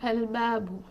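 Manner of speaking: "al-babu" spoken softly, lam clearly heard; soft slow read-out. Shown as a demonstration. Teacher quietly reading out the word for students. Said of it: "I will say".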